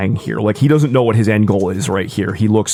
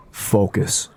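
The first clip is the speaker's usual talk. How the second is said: ordinary talk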